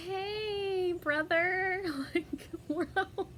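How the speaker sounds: high, sing-song fake innocence; a breezy "nothing to see here" to the cops; fake casual greeting.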